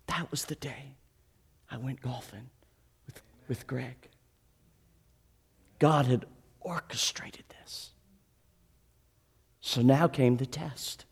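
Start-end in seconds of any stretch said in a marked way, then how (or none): 0.1-7.9 very soft, near whisper; fighting back emotion; preacher barely holding it together, nearly whispering; hushed, moved. Real, not acted. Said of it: "hard for me to hold it together".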